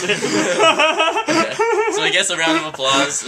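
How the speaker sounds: laughing while telling it, voice jumping high and loud. Real, not acted. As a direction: crack up mid-sentence. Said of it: "And everyone's like".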